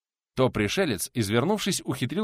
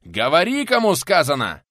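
loud, raised, insistent roar; furious shouted order; enraged yell; 7 semitones higher, 8 dB louder than usual. A narrator's reading. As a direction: demand an answer at full voice. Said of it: "в лицо буквально проорал пенсионер".

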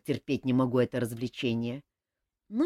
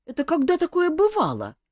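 higher and louder, outraged protest; indignant; 12 semitones higher, 5 dB louder than usual. A narrator's reading. Elmar protesting to the king, indignant. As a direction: bristle at the insult. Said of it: "возмутился Элмар".

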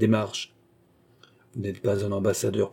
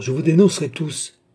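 menace criée; 5 semitones higher, 5 dB louder than usual. A narrator's reading. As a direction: menace lancée haut et fort. Captif qui menace de dénoncer. (protestation furieuse de prisonnier)

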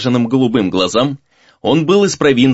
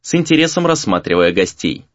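ordinary delivery

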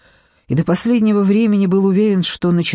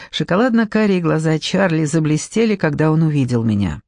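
ordinary delivery

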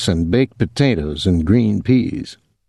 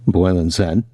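ordinary delivery

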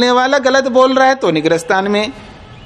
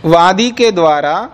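slow dictation; slower than usual. Acted. Dictating the sutra slowly.

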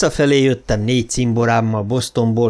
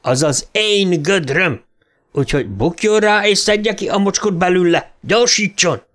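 higher, rising barked orders; yelling; 7 semitones higher than usual. Acted. Sergeant screaming orders at the private, a higher bark. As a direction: bark it at him to dig. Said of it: "üvölti".

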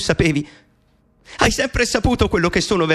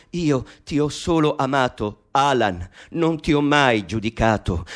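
voce materna più grave, sommessa; voce della madre; 7 semitones lower than usual. Acted. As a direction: parla come una madre che consola il figlio, bassa. La madre risponde ad Alan. (la madre risponde ad Alan, voce grave e dolce)

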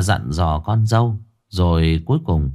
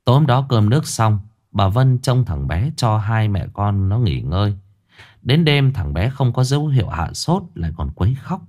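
usual speaking voice

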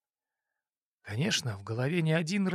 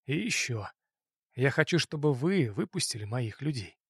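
ordinary talk

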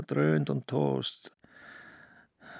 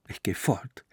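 whisper; 4 semitones lower than usual. Acted. Answering in a whisper.

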